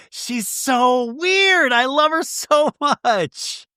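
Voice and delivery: high, drawn-out affectionate squeal; delighted gushing. Real, not acted. Host gushing about a woman she loves, delighted. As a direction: gush with stretched words and a laugh.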